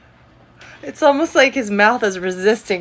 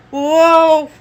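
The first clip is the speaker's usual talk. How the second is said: surprised; 6 semitones higher, 6 dB louder than usual. Real, not acted. Startled by his pull-away.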